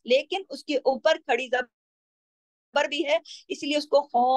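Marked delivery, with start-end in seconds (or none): 4.0-4.2 full-mouthed खौ held one alif long; heavy, stretched kha. Shown as a demonstration. Teacher shows a heavy letter, खौ thick and lengthened. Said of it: "अलिफ के बराबर खींचेंगे".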